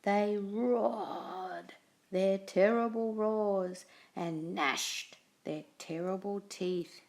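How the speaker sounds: ordinary delivery, nothing marked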